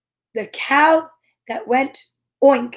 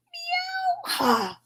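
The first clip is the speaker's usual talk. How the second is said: very high, soft meow; tiny high kitty meow; cat meow; 8 dB quieter than usual. Acted. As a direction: mew like a little cat. Farm animals laughing at cow.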